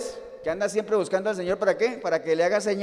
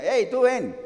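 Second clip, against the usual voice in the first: raised, louder acted summons; calling someone over; 4 semitones higher, 5 dB louder, slower than usual. Preacher acting out those who summoned Simon, raised call. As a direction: call out higher and louder, as to a bystander.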